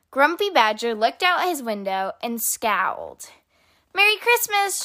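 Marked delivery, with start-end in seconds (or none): 3.9-4.8 high, bright holiday greeting called out; cheery shout. A narrator's reading. Squirrel calling a greeting to Badger, high cheery shout. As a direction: call it out to the badger high and happy. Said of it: "shouted Squirrel".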